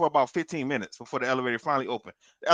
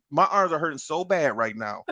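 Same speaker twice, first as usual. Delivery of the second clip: normal talking voice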